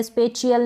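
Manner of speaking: "स्पेचियल" with CH, spe-chi-yal; wrong CH sound. Shown as a demonstration. Teacher warning against a misreading, "स्पेचियल" with CH. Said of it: "स्पेचियल नहीं पढ़ेंगे".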